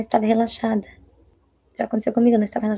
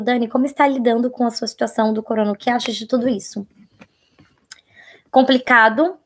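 ordinary talk, nothing marked

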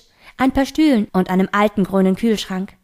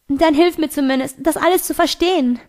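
higher, pleading plea; mild begging, pitch raised; 6 semitones higher than usual. A narrator's reading. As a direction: beg him to help you understand. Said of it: "bettelte ich".

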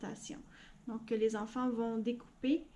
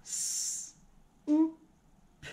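robot voice; 5 semitones higher, much slower than usual. Shown as a demonstration. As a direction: separate sounds, one at a time. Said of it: "Comment on va dire soupe en robot". (voix de robot, sons détachés)

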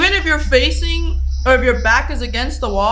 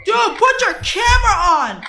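usual speaking voice

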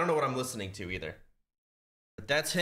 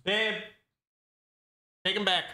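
calling out; 8 semitones higher, 4 dB louder than usual. Real, not acted. Calling partner off-mic.

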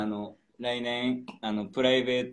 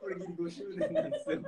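laughing; 5 dB quieter than usual. Real, not acted. Laughing about the anniversary gap.

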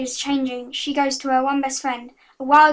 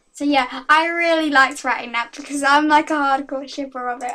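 normal talking voice

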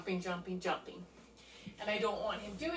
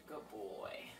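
whispered praise; 10 semitones lower, 17 dB quieter, much slower than usual. Real, not acted. Trainer rewarding eye contact, soft whispered praise. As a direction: whisper it to keep him calm.

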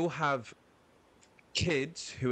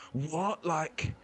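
higher-pitched, rising mock "what?"; imitated bafflement; 6 semitones higher than usual. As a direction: act like a thrown onlooker. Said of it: "it kinda scrambles their brain".

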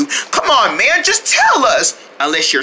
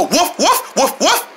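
puppy bark; 6 semitones higher than usual. Acted. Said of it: "[name] can do the woof".

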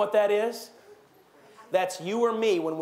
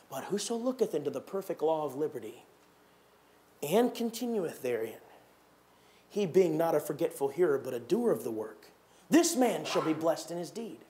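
scripture reading; 4 semitones lower, 3 dB quieter than usual. Acted. Preacher to congregation, solemn scripture reading. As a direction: read the King James words with reverence.

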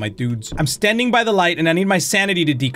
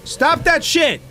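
high-pitched startled outcry; startled protest; 7 semitones higher, slower than usual. Real, not acted. Player objecting to the game's scare, startled protest. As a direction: protest in alarm, pitch jumping up.

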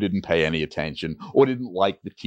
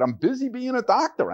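higher, impatient and dismissive; impatient doctor. Retold or imitated. Doctor telling young salesman he's too busy, impatient. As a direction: wave it off, too busy to care.

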